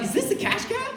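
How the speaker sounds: squeaky high-pitched disbelief; incredulous question. Acted. Arrested kid asking cop about cash cab, squeakily incredulous. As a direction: pitch it way up, baffled.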